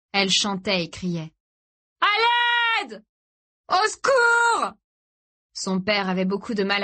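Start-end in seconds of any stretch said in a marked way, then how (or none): 2.0-4.7 very high, drawn-out screams for help; desperate shout. A narrator's reading. Boy calling to people leaving a bar, desperate yell. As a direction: yell to people far away. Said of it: "cria encore et encore".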